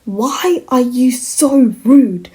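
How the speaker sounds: slow, drawn-out exasperated question. Real, not acted. Viewer scolding a rude anime character, exasperated. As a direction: stretch each word in disbelief.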